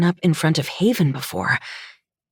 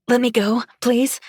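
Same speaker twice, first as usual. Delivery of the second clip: higher-pitched, uneasy plea; timid plea; 7 semitones higher than usual. A narrator's reading. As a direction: plead carefully to be released. Pleading with drunk who grips her.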